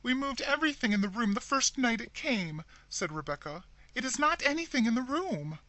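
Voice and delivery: high, loud fearful insistence; insist, half panicked; frightened insisting. A narrator's reading.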